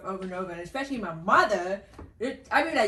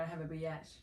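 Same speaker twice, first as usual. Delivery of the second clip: soft, low correction; quiet correction; 4 semitones lower, 10 dB quieter than usual. Real, not acted. Daughter names the wrong degree, quietly corrected.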